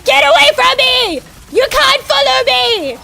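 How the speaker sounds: hammed-up escape shouting; mock panic shout. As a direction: scream like running from a monster. Fleeing from adulthood in jest.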